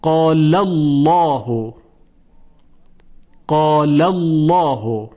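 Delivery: slow "قال الله", heavy dark l in "الله"; full, rounded lam after the fatha in "قال الله"; thick lam. Shown as a demonstration. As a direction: recite "قال الله" as a drawn-out "qaalallawhu". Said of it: "قبل از لام الله فتحه وجود".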